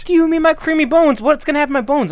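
high, loud panicked outburst; alarmed shout. Acted. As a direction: freak out about it.

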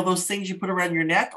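usual speaking voice

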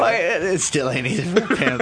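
laughing through a movie line; laughing quote. Retold or imitated. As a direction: giggle the pimp line.

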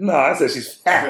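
slow, high correction landing loudly on "fat"; stress on FAT. Retold or imitated. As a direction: hit "fat" hard like an insistent kid. Son firmly corrects dad's word.

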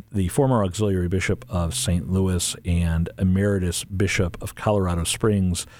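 normal talking voice

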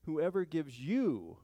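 slow, stressed you; emphasis on you. Shown as a demonstration. Pastor pointing out the pronoun, slow emphasis. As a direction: lean on you slowly. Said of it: "I wanted to show you this".

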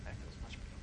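soft, breathless relief; relieved whisper. Retold or imitated. Seeing the light, a relieved hushed whisper. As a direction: whisper it as the fear lifts. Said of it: "I was like".

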